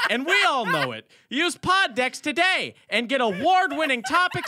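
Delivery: loud, high pitchman voice; Billy Mays ad read. Acted. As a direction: shout it like an infomercial host. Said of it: "the Billy Mays ad read".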